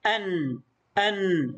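أن as "an", closing on a light held n; fatha tanween. Shown as a demonstration. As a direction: repeat أن slowly, nun lingering. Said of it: "وأضفنا لها نون ساكنة خفيفة".

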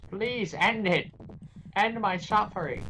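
high, slow hammed-up begging; mock anguished plea. Game enemy begging for an end to suffering, mock anguish. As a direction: wail it out as a suffering henchman.

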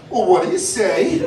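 rising, teasing what do you say; playful scolding of a rude parent; mock reprimand. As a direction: turn the mom's line back on her sarcastically. Waiter mocking the mom back.

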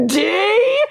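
high, stretched single letter; high, drawn-out retold call; spelling it out. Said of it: "She tries to spell it out".

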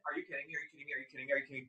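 breathless disbelief. Real, not acted. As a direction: murmur it over and over, stunned. Stunned by the try-on.

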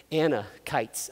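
"Anakites" slow and low, split as Anna-kites; careful pronunciation. Shown as a demonstration. Preacher correcting his own slip, "Anakites" slow as Anna-kites. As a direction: sound out "Anakites" in separate parts, softly. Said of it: "I keep adding an Amla".

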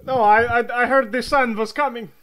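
loud, high frantic insisting; panicked. Acted. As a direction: blurt it out in alarm. Doctor panics about the sun.

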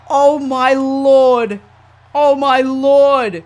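very drawn-out, amazed words; slow awe. Real, not acted. Gamer reacting to the suicide dive, slow awe. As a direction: stretch each word out in amazement.

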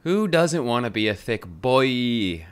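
slow, lightly voiced mock bro; half-joking slow put-on voice; silly put-on voice. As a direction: stretch thick boy out in a goofy voice. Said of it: "I don't know why I have to say it like that".

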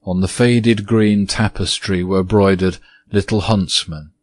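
ordinary delivery, nothing marked

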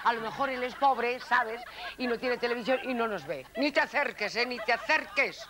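ordinary talk, nothing marked

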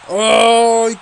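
high, loud sudden "ay"; loud cry of dismay. Real, not acted. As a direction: yelp at the near miss. Near miss in match.

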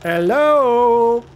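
loud, high call into the dark. Real, not acted. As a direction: call out to anyone inside. Calling into room.

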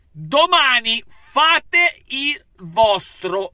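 emphatic urging. Real, not acted. Urging the players for tomorrow.

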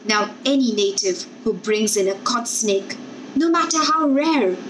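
plain reading voice

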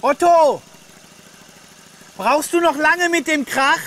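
loud annoyed call across the house; angry shouting. Real, not acted. Ruft genervt durchs Haus. (genervt laut rufen)

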